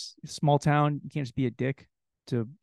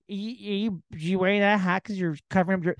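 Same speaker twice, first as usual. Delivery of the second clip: mock woman voice; 5 semitones higher than usual. Woman's question again.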